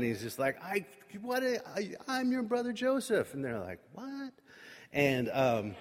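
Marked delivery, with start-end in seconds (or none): 0.6-3.3 high, choked-up mock confession; retold tearful outburst. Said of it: "He starts crying". 3.9-4.3 high, small gasped question; mock shock, high and soft; stunned disbelief. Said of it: "'cause they can't believe it".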